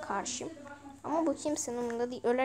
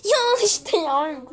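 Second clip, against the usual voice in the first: very slow, loud, high drawn-out whine; exasperated wail; 7 semitones higher, 14 dB louder, much slower than usual. Real, not acted. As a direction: stretch it out like you can't take it anymore. Keeps dying in game, exasperated. (çok yavaş, yüksek sesle uzatılmış sızlanma)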